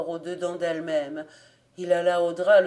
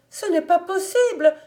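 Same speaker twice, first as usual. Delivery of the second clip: much higher and loud, the cry climbing into panic; shriller shrieking denial; 11 semitones higher, 8 dB louder than usual. A narrator's reading. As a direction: scream it again, even more desperate. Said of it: "hurla par trois fois".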